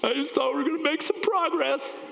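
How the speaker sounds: mock tearful child, high and loud; crying kid. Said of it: "He's like".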